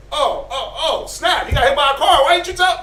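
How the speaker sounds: sudden mock panic that he got hit; mock alarm. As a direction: jump in with fake surprise.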